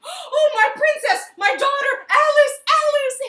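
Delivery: high, loud joyful calling out; king's cry. Acted. King runs to his laughing daughter, high loud joyful call. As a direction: call out to your daughter in delight.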